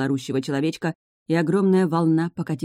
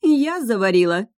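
high-pitched sarcastic outrage; caustic scoff; 6 semitones higher, 4 dB louder than usual. A narrator's reading. Witch throwing the blame back at the heroine, caustic scoff. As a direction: throw it back, stung and mocking. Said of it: "язвительно хохотнула Урсула".

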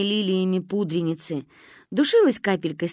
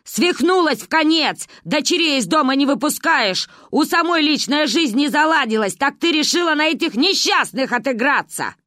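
high, loud shrieking neighbour; screaming quarrel; 7 semitones higher, 5 dB louder than usual. A narrator's reading. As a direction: scream at her rival.

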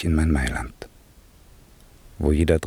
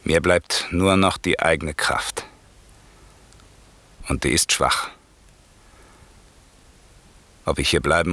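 solemn epilogue; 4 semitones lower than usual. Acted. Epilogue spoken to audience.

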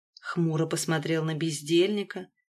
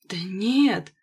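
ordinary delivery, nothing marked

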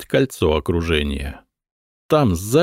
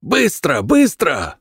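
higher urgent hoarse shouting; hoarse yell; 8 semitones higher, slower than usual. A narrator's reading. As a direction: hurry them, yelling hoarsely. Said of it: "хрипло заорал он".

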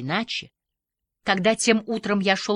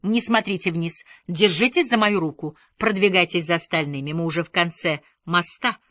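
usual speaking voice

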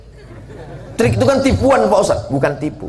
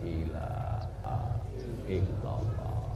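dying whisper; 7 semitones lower, 15 dB quieter, much slower than usual. Acted. A dying man's last words.

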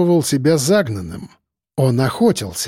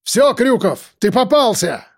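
shouting; 8 semitones higher than usual. A narrator's reading. Shouting at the cornered maniac.

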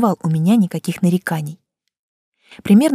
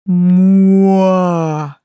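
loud drawn-out moo; bull bellow; 4 dB louder than usual. A narrator's reading. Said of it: "Это было мычание, переходящее в рёв". (протяжное мычание быка)

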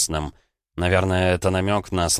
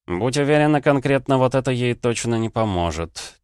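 usual speaking voice

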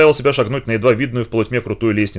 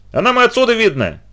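put-on high, taunting girl's retort; snide sing-out, much higher; ехидный выкрик; 10 semitones higher than usual. A narrator's reading. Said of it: "ехидно крикнула Литка". (ехидно, тоненько)

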